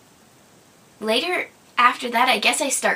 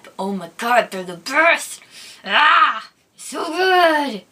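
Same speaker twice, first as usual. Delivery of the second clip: gushing rave; 3 semitones higher, 4 dB louder, slower than usual. Real, not acted. Vlogger raving about favorite breakfast cereal, gushing. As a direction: build to a loud, delighted outburst.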